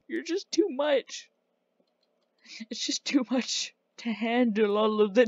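high, hammed-up heartbreak; mock breakup, pitched high. Breaking up with villager Jared, hammed-up heartbroken. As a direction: play the dumped lover, voice up high.

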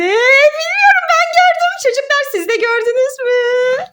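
high, loud singsong tease; playful tease. Pretend. Mother teasing her son during the game, high playful singsong. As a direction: tease him that you saw it first.

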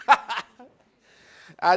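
bursting into a loud, moved laugh; loud real laugh. Real, not acted. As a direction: laugh out loud, overcome by the story. Emotional burst while retelling story.

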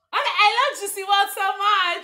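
triumphant shout over headphones. Real, not acted. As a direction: yell the whole line out. Shouting the phrase over music.

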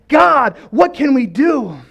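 loud, high, stretched plea; passionate crying out. Acted. Habakkuk crying out to God for help, passionate shout. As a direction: call out slowly with passion. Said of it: "starts to cry out with passion to God".

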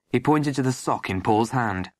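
usual speaking voice

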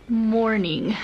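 usual speaking voice

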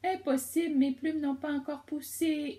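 high, loud little owl voice; hooting baby owl. Acted. The baby owl protests to the cub, high and loud. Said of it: "ulula Couette".

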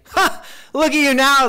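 high, loud mocking shout; scornful shouted taunt; jeering yell. A narrator's reading. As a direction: yell abuse like a passerby. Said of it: "they yelled".

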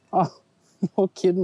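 normal talking voice

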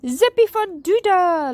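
high, loud dragon whoop; gleeful cheer. A narrator's reading. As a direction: cheer with giddy delight. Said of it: "laughed Sparky".

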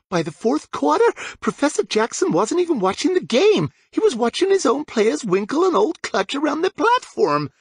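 put-on high, indignant girl voice; high and huffy about the werewolves; perky pixie captain. A narrator's reading. Ophelia complaining to James about the werewolves.

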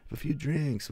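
mock the classic quip in a squeaky parody; squeaky high parody of the cocky hero; mock John McClane. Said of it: "and he's like".

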